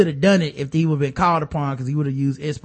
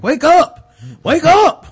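loud high shout; shouting wake up; 11 semitones higher, 7 dB louder, slower than usual. Retold or imitated. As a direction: yell it while shaking her awake. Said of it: "was like".

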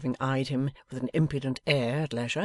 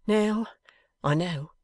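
higher-pitched meek admission; 5 semitones higher, 3 dB louder, slower than usual. A narrator's reading. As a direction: concede it meekly. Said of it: "said Mister Boffin meekly".

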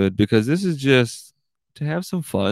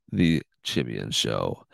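deep sign-off; 12 semitones lower, 6 dB quieter, slower than usual. Pretend. Show sign-off.

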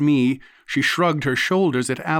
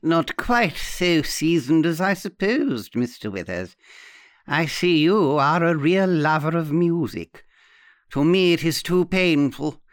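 put-on arch old aunt; 3 semitones higher than usual. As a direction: draw it out like a knowing old hostess. Aunt's voice in the story.

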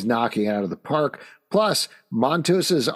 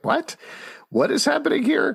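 surprised. Retold or imitated. Baffled reaction to comic twist.

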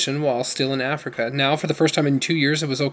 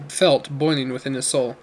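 ordinary delivery, nothing marked

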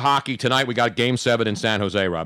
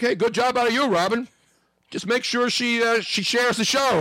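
high, hammy voice, slowed at the end; high mock voice; 10 semitones higher than usual. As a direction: ham it up in a high silly voice. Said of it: "and I'm like".

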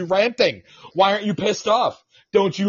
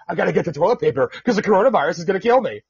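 fast panicky mock of a toilet paper hoarder; much faster than usual. Podcaster mocking panic buyers, fast and panicky. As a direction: rattle it off like a frantic shopper.

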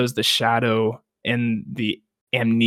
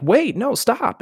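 high pleading wait, no, stop; mock urgent call; 11 semitones higher, slower than usual. Brandir crying out to Nienor at the cliff, high urgent call. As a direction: call her back from the edge, far higher. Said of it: "Brandir's like".

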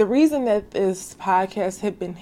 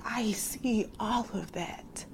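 much softer, tender reply in God's voice; God's gentle answer; 3 semitones higher, 10 dB quieter than usual. Retold or imitated. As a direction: answer softly, like God comforting her. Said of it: "And God is like".

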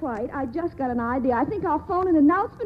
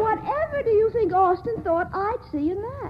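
ordinary delivery

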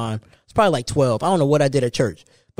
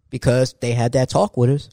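usual speaking voice

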